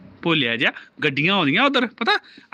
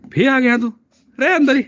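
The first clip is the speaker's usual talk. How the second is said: loud, high playful telling-off; mock scolding; 9 semitones higher, 7 dB louder than usual. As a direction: shout it as a pretend reprimand. Mock scolding the returning child. (ਝੂਠੀ-ਮੂਠੀ ਉੱਚੀ ਝਿੜਕ)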